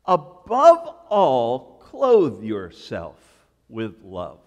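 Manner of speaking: "Above all" drawn out slowly and loudly; slow emphatic repeat. Shown as a demonstration. Pastor repeating the verse for emphasis, slow and weighty. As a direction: dwell on each word of the verse. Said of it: "pastor repeats the verse slowly".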